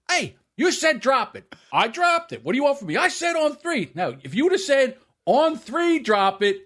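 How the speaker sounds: high, heated self-defense, acted out; mock arguing yell. Son arguing with his bleeding father, high indignant protest. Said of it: "he's yelling back and forth".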